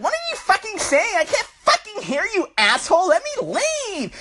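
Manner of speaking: high, strained shout over the bass; yelling over music. Retold or imitated. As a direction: scream to be heard at a rave. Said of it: "they're like".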